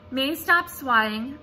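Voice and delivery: usual speaking voice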